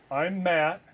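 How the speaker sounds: loud insistent I'm Matt; emphatic. Real, not acted. Keeping it real with the audience, loud. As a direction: declare your name firmly.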